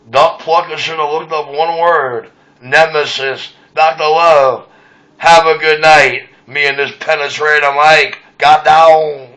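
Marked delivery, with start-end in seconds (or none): 3.7-6.3 radio sign-off. Acted. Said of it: "radio sign-off repeated".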